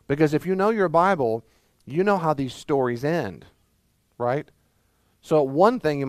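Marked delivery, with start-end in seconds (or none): none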